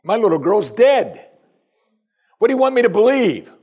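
high, loud disbelief; incredulous grieving father. Retold or imitated. Grieving father Jairus protests to the preacher, incredulous and loud. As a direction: push back in raw disbelief. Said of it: "I think if I'm Jairus".

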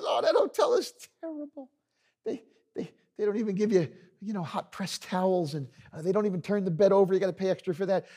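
high-pitched, exaggerated complaining; hammed-up disgust from a naysayer; discouraging barber. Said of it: "known around town as the discouraging barber".

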